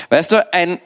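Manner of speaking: usual speaking voice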